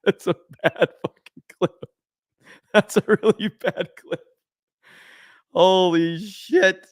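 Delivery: words broken up by laughter; helpless laughing. Real, not acted. Streamer reacting to a terrible clip, laughing. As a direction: try to talk while cracking up.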